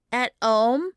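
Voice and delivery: as if it's obvious; struck by their denseness. A narrator's reading. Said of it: "evidently struck with their denseness".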